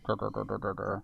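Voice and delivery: low quiet rumbling growl. Retold or imitated. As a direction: growl softly under your breath. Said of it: "He's more like".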